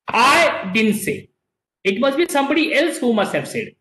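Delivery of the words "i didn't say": very slow, with I drawn out and leaned on; stress on I. Shown as a demonstration. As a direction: drag out I to show it wasn't me. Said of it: "The meaning of this sentence is".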